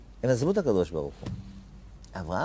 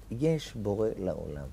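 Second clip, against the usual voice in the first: ordinary delivery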